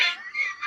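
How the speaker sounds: high squealing laugh of disbelief; shrieking laugh. Real, not acted. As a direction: burst into a shrill exasperated laugh. Narrator breaks down over story.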